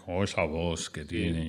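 lightly imitated Argentine accent, slow and low; a hint of Kevin's ironic Argentine drawl; Argentine irony. Imitating Kevin telling the story, lightly Argentine. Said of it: "Kevin lo contaba con su ironía argentina".

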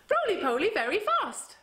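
fast high roly poly. Shown as a demonstration.

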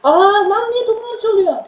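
high and loud, outraged; indignant outcry. Real, not acted. Gamer protesting as the opponent steals his ball, high indignant yelp.

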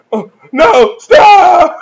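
very high, very loud mock shriek; hammed-up panicked oh no, stop; mock terrified hero. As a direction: scream it like a scared maiden. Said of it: "he's like".

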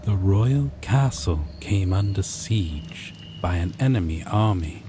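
ordinary delivery

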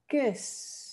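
"Kus" con u corta redondeada holandesa; Dutch kus. Shown as a demonstration. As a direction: di "Kus" con vocal entre u y e. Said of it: "cómo suena esta palabra". (pronunciación holandesa de "Kus")

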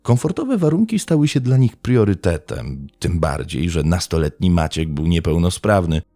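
ordinary delivery

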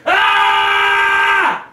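harsh long bellows of rage; raging scream. Real, not acted. Dad over his broken chair, raging scream. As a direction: scream out the fury, wordless.

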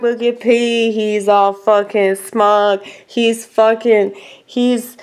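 normal talking voice

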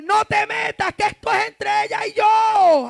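high, loud rebuke; loud voiced command from the Lord; God's stern command. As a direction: shout it as God's firm order. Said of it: "¿y sabe qué me dijo el Señor?".

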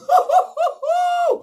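high burst of laughter; a squealing laugh at the drama; shrieking laugh. Real, not acted. Viewer laughing at Ovi's outburst, high shriek. Reacting to the scene.

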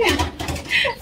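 a high giddy laugh; high giggle. Real, not acted. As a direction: laugh out the excitement. Laughing at own excitement.

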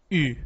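U as French u, tongue forward as for I, lips rounded; pouted French u vowel; French u sound. Shown as a demonstration. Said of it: "Boca do U, letra I".

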